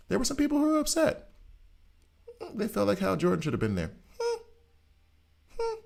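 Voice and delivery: high, fast, whiny mock sob; mock upset fan. Mocking fans upset about John Stewart, high whiny fake crying. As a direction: whimper like a fan pouting over Hal Jordan.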